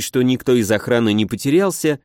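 usual speaking voice

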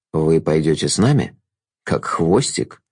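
low, slow, smooth jester voice; silky courtly trickster; sly jester. A narrator's reading. Jester's lines.

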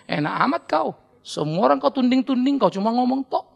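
ordinary delivery

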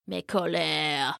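low, slow, soft grumble; grumbling king. Acted. Old king grumbling at the news, low and slow. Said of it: "grumbled the king".

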